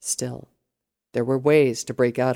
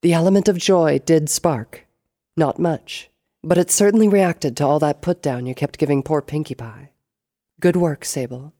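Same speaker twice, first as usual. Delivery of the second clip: higher, louder, arch delivery; 3 semitones higher, 8 dB louder than usual. A narrator's reading. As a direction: commend an underling loftily.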